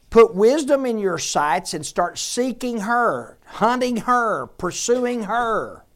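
high, loud, driving exhortation; full-force preaching. Acted. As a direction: press every command on the congregation.

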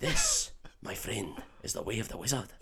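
soft put-on Scottish accent; Oliver Wood. Quidditch keeper teaching Harry, hushed Scottish accent. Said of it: "for me to do a Scottish accent".

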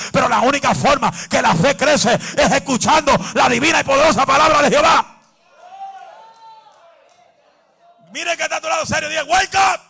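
rising to a loud shout; preacher urging the congregation to shout; building preaching. Acted.

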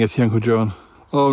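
ordinary talk

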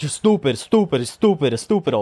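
imitated Puerto Rican man losing it; agitated Puerto Rican accent, repetitive; flying off the handle. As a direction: rattle off insults in a mock Puerto Rican accent. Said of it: "he's flying off the handle".

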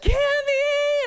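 high, loud tearful cry of her name; mother grabbing her found daughter, tearful; relieved cry. Retold or imitated. Mom finds lost daughter.

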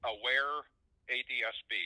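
slow, with a pause before the letters; slow repeat. Real, not acted. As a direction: say the name again slowly and distinctly for the listener. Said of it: "could you say that again slowly".

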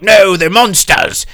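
a stubborn sailor barking back; gruff man voice. Retold or imitated. Gruff guy voiced in recap.

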